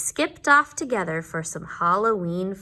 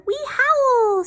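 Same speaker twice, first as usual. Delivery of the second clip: high, louder howled word; werewolf howl; 7 dB louder than usual. Acted. Werewolf father to his wolvies, howling. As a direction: howl it like a wolf.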